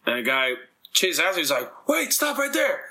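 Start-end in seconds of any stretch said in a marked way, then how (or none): 1.9-2.8 much higher, half-shouted command; cop yelling stop. Retold or imitated. Cop chasing the runner, higher mock yell. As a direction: call him to stop like a cop giving chase.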